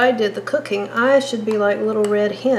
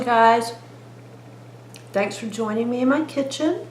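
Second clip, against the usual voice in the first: usual speaking voice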